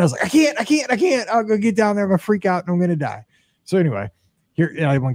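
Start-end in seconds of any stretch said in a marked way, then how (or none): 0.3-3.2 panicked pleading. Retold or imitated. Panicking before going into tank.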